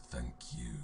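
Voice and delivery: low, soft ghost voice; ghostly whisper. Acted. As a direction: breathe it out like a dying ghost. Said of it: "this is a ghostly whisper".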